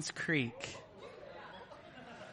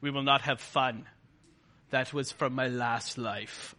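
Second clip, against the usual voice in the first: mopey killjoy voice, dragging the end; 7 semitones lower, 5 dB quieter than usual. Retold or imitated. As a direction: sulk it out like a joyless churchgoer. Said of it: "So mourning is not being a downer".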